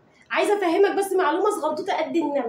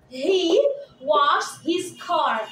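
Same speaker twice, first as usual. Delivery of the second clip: very slow, word by word; slow dictation; much slower than usual. Shown as a demonstration. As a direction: dictate slowly for copying. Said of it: "dictating example".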